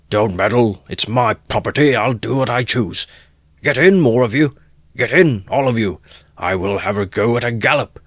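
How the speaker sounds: defiant rage. Acted. Mikulka defying the protesting crowd, angry shout. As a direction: defy them all.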